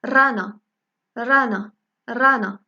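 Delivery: رانا opening on a hard trilled R; strong initial R. Shown as a demonstration. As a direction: roll the R hard at the start of رانا. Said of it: "في أول الكلمات كتنطق بالشدة".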